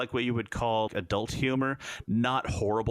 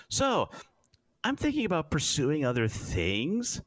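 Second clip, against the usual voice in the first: nervous ensign; 8 semitones higher than usual. Retold or imitated. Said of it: "chief engineer's like".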